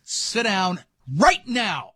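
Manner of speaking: slow, firm command, punched RIGHT NOW; stern father laying down the law; dad voice. Shown as a demonstration. Dad ordering kids to sit, firm dad voice. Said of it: "I used what I call the dad".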